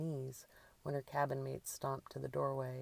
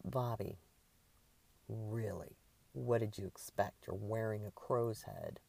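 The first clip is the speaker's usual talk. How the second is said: lower, dry, unimpressed; exasperated reproach; 4 semitones lower than usual. A narrator's reading. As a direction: reason with a ridiculous friend, weary and low. Carla reproaches masked Bobby.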